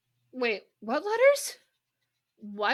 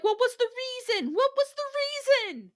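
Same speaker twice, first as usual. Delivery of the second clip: rising, louder, higher what was the reason; incredulous; 12 semitones higher, 3 dB louder, much faster than usual. Real, not acted. Asking the co-hosts why the wolf attacked, incredulous. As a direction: demand an answer in disbelief.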